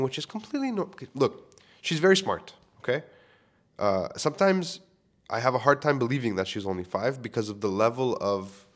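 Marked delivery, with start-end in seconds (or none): none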